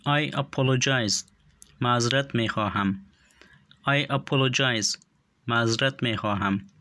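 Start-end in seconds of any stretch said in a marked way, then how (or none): 0.1-1.2 each word said slowly and distinctly for learners; slow and clear. Shown as a demonstration. Teacher models English phrase for learners, slow and clear. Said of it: "به خاطر آموختن تلفظ درستشان". 3.9-5.0 each word said slowly and distinctly for learners; slow and clear. Shown as a demonstration. Teacher models English phrase for learners, slow and clear. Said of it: "به خاطر آموختن تلفظ درستشان".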